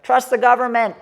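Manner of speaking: loud, high droning public call; mock megaphone announcement. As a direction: call it out like a sarcastic street announcer. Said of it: "with a megaphone. He was like".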